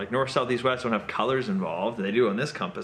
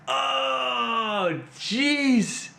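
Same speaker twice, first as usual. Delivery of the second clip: high, frustrated outburst at yourself; exasperated self-scolding; 8 semitones higher, much slower than usual. Acted. Player realising he missed the easy answer, exasperated. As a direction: kick yourself aloud for missing the obvious.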